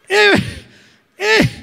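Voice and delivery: ordinary talk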